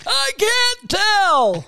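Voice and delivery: very high, drawn-out strain; breath-held old voice. Pretend. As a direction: squeeze the words out slowly. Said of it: "are you holding your breath".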